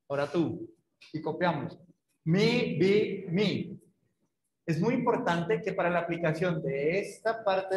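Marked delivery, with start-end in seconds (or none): 2.2-3.8 mi, bi, mi spaced a second apart; spaced syllable drill; syllables one per second. Shown as a demonstration. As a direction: say each syllable separately for copying. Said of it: "una por segundo".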